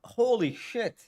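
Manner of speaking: slow, stunned holy shit; mock shock. Streamer reacting to sexual jokes in a chat, slow stunned disbelief. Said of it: "You're like".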